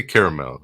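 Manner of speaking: "caramel" said KAIR-uh-mel; three-syllable caramel. Real, not acted. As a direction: say every syllable of "caramel", as spelled. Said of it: "that's the way it's spelled".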